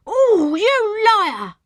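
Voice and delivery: high, loud, drawn-out squeal; slow, piercing cry of indignation; indignant squeal. A narrator's reading. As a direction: squeal it in outrage. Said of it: "the fat woman squealed indignantly".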